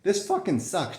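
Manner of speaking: imitating a complainer, half-hearted; mock complaining. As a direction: toss off a sarcastic complaint. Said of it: "just, like, complaining".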